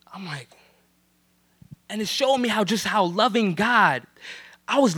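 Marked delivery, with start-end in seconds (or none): 0.1-0.5 whisper. Real, not acted.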